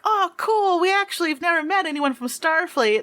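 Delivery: put-on high-pitched childlike delight; excited little fan; awestruck kid. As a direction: gush like an impressed kid. Game master acts awestruck kids.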